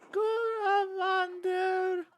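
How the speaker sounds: put-on very high, hugely drawn-out child voice; badly faked child. Child greets Andres in the morning, in a badly faked high voice. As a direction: squeeze out a slow, squeaky attempt at a child. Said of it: "I can't do a child's voice".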